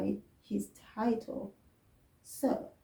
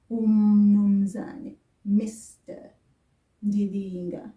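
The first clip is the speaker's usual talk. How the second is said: "UMnumzane" loud and very slowly drawn out; slow demo with u; 5 dB louder, slower than usual. Shown as a demonstration. Teacher slowly showing the u form. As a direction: stretch out "UMnumzane" with the "u" clear. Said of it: "I had u before his name".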